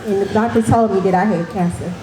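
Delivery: ordinary talk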